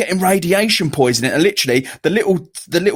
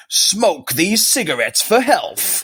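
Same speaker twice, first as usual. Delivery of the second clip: mock ad; 6 semitones higher, slower than usual. Mock old cigarette advert.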